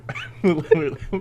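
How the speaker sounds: quick amused laugh; a burst of laughter at the joke; short laugh. Real, not acted. Laughs at own mock quote.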